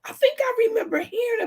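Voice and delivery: high, sudden recognition; ooh, it comes back to her; surprised. Real, not acted. Realizing she remembers.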